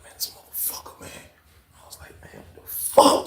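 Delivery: barely audible cursing; whispered exasperation. Retold or imitated. Inmate fed up with cellmate, whispered exasperation.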